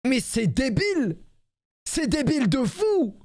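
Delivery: high-pitched, drawn-out indignation; exasperated disbelief. Real, not acted. Speaker ranting about classmates mocking real accents, exasperated.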